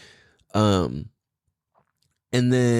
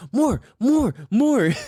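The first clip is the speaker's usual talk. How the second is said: put-on toddler demanding; 11 semitones higher, much slower than usual. Dad imitating his son begging for waves, high eager chant. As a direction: beg for more like an eager small boy. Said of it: "where he's like".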